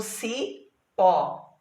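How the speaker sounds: "cipó" drawn out as ci, pó; slowly part the syllables of "cipó". Shown as a demonstration. Teacher gives example of two syllables, "cipó" split slowly. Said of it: "São palavras que têm duas sílabas".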